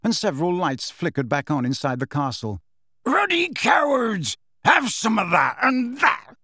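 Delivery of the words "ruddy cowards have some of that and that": furious bellow. Acted. Hagrid fighting off attackers.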